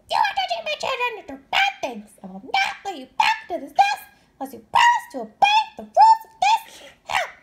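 very high, fast, building to a loud shout; a furious mother laying down the law; high shrill scold rising to a yell; angry mother bird. Acted. Mama Bird scolds Stellaluna.

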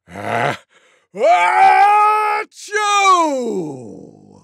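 enormous drawn-out tiger sneeze; loudest sneeze imaginable. Acted. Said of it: "gave the loudest sneeze you could ever imagine".

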